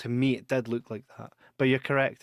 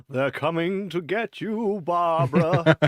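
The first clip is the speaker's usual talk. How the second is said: spooky movie quote; 4 semitones higher, slower than usual. Retold or imitated. Quoting horror film line.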